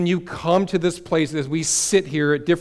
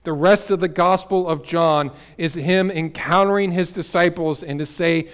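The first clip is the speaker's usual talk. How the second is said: usual speaking voice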